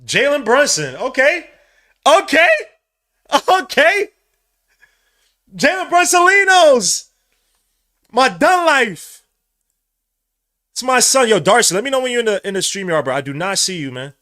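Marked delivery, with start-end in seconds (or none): 0.0-1.0 name shouted higher and louder; excited cheer. Real, not acted. His player wins MVP. 1.0-12.0 rising, much higher, louder okays; ecstatic cheering. Real, not acted. Celebrating the MVP win.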